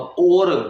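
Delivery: और said full and loud; stressed or. Shown as a demonstration. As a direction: punch और as a clear separate word. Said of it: "या के ऊपर अगर मैं एक जोर दे रहा हूँ". (और पर ज़ोर)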